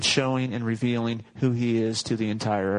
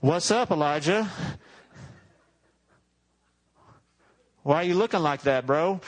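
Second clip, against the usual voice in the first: casual bro God; 2 semitones higher than usual. Acted. God checking on Elijah, a hint of a laid-back bro. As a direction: greet him like a buddy, slangy and easy. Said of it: "I'm translating. This is John's version".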